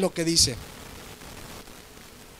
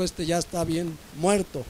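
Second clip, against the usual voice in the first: muttered imagined king; 3 semitones lower, 8 dB quieter than usual. Retold or imitated. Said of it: "A lo mejor dijo".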